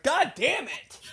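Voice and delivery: a high, strained mock curse; a hammed-up high-pitched damn it; exasperated groan. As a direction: squeak it out in defeat. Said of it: "You're like".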